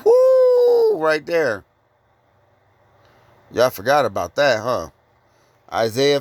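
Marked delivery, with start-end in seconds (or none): 0.0-1.0 sudden high whoop of excitement; preacher's shout of delight; excited whoop. Real, not acted. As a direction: let out a high joyful woo. Preacher exclaims at scripture.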